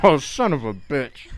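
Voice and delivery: swearing through a packed mouth; muffled curse, mouth full; cheeks stuffed full. Real, not acted.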